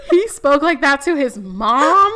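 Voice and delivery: loud, high, drawn-out incredulous question; loud disbelief. Acted. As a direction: say it scandalized, on the edge of laughter. Shocked reaction to her own story.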